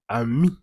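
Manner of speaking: careful teaching model of "ami", ending on a clear ee; "ami" in two clean syllables, French style; French pronunciation. Shown as a demonstration. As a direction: say "ami" as ah-MEE. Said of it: "Se pronuncia igualmente".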